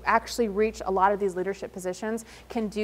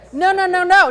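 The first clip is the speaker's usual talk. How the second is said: playful no; 7 semitones higher, 9 dB louder than usual. Real, not acted. Correcting audience jokingly.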